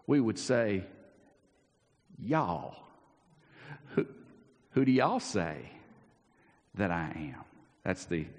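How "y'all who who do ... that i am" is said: Southern drawl. Pretend. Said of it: "That's the Southern Standard Translation".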